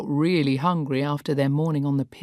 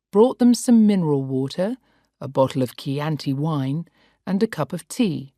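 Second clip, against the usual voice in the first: ordinary delivery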